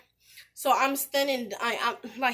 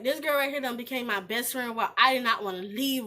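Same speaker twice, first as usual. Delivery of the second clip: ordinary talk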